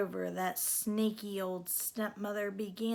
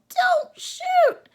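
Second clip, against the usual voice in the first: high, loud frightened yell; Cindy yelling in fright as the gun comes out, much higher and louder; yelling don't shoot; 12 dB louder than usual. Acted. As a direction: yell it in panic. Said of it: "Cindy yelled".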